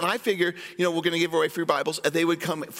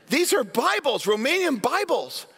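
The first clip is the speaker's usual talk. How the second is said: stern border guard; 8 semitones higher than usual. Retold or imitated. Guard repeats his discovery.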